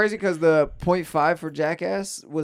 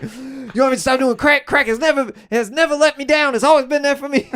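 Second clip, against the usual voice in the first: higher hammed-up lament for crack; mock devotion; 7 semitones higher, 4 dB louder than usual. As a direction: plead loyalty to crack, half laughing. [name] defends crack, laughing.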